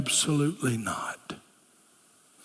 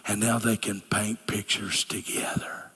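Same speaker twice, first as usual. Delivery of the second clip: hushed, softer whisper; 7 semitones lower, 5 dB quieter than usual. Retold or imitated. Young lady sharing her insight, hushed whisper. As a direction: let it fall to a whisper.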